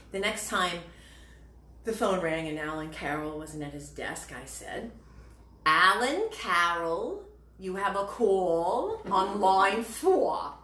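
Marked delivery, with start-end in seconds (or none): 5.7-10.5 slow, drawn-out Brooklyn intercom page; exaggerated Brooklyn accent. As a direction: stretch every word in a hammed-up Brooklyn accent. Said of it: "I think I know what you need".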